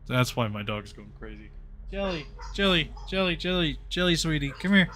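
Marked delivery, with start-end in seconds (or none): 1.9-4.9 coaxing high dog-calling voice. Real, not acted. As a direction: call your dog sweetly.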